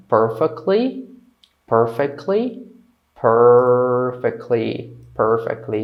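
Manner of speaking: very slow, stretched PERFECTLY; drawn-out model pronunciation. Shown as a demonstration. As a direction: linger on the first syllable of PERFECTLY. Teacher models word drawn out.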